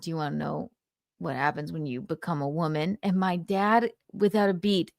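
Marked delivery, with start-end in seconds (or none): 0.0-3.0 much softer, lowered invitation; lightly imitated mom, quiet and coaxing; hushed mom question. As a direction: ask it softly like a mother sharing a secret. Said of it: "she went".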